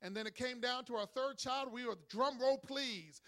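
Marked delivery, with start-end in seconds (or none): 2.1-3.2 slow announcement. Retold or imitated. Announcing the third child.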